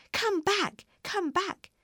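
high, urgent cry of "come back"; shouted call. A narrator's reading. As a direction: call out after a runaway, pitched right up. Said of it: "shouted Dorothy".

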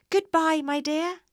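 loud, high farewell shout; calling out. A narrator's reading. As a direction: call goodbye across a great distance. Balloonist calls from rising balloon.

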